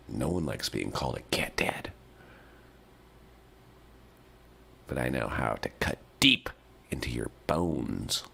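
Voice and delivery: low, soft whispered threat; menacing whisper. Acted. Owner whispers a low soft threat to the cat dad. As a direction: whisper it to cut deep.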